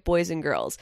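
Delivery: normal talking voice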